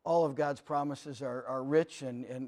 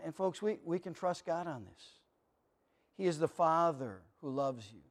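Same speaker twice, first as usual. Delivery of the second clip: ordinary talk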